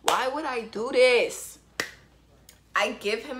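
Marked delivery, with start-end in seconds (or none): 0.0-1.6 louder, slower, despairing at herself; loud slow groan of regret; exasperated self-reproach. Real, not acted. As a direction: drag it out in disbelief at your own mistake. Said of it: "My stupid self".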